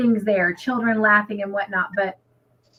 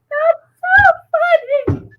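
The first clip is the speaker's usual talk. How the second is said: hammed-up tearful wail, very high and loud; exaggerated high sobbing squeal; laughing-crying, imitated; 6 dB louder, much slower than usual. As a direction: squeal it slowly as if helpless with laughter. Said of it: "I'm laughing so hard I'm crying".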